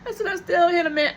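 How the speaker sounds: high, teary half-laughing cry; retold tearful insistence. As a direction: sob out the words with a laugh. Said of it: "I was crying".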